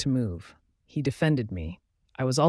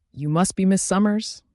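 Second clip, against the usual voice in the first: menacing; 6 semitones higher, 5 dB louder than usual. Acted. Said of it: "asked in a menacing tone".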